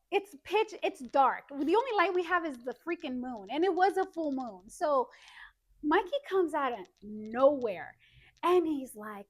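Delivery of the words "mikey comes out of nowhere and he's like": normal talking voice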